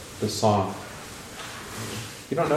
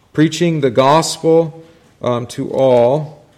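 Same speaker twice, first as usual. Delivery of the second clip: slow, louder preacher cadence; proclaiming scripture; 4 semitones higher, 7 dB louder, much slower than usual. Acted. Teacher reads Mark to students, slow louder proclamation. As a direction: proclaim it to the whole room.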